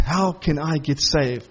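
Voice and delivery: slow, low, earnest plea as the jailer; pleading jailer. Retold or imitated. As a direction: draw it out like a desperate question. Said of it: "And he says".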